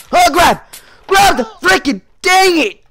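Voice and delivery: annoyed gamer shouting; frustrated shout. Real, not acted. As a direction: yell at the game in frustration. Frustrated failing to grab.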